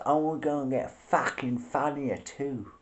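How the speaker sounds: bad Cockney accent. Vlogger tries a Cockney accent and admits it was terrible. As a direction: try a Cockney accent and miss it a bit. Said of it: "I can do a good Cockney accent, but that one was terrible".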